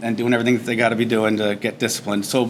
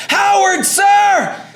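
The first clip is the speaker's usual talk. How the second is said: shouted name, much higher and louder; recruit's shout; 6 dB louder, much slower than usual. Retold or imitated. Recruit yells his name back at the drill instructor, shouting. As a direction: bark it back at full volume.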